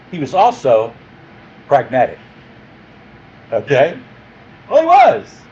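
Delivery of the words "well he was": emphatic aside. Acted.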